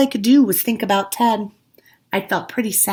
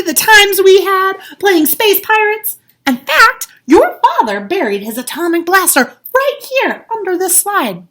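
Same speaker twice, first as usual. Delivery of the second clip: high, loud, gleeful storytelling voice; bubbling over with nostalgia; excited Ted; 12 semitones higher, 11 dB louder than usual. A narrator's reading. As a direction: relive the old adventures.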